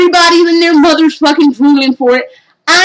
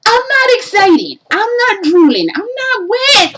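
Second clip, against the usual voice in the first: exasperated rant; 7 semitones higher than usual. Real, not acted. Ranting about VR ads.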